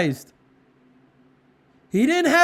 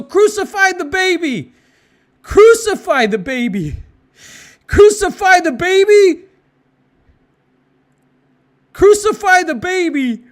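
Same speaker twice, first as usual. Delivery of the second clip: higher, much louder enraged chant; furious mob yell; 6 semitones higher, 8 dB louder than usual. Retold or imitated. Preacher voicing the mob at its peak, furious shouting. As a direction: scream it like a frenzied crowd. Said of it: "They're yelling".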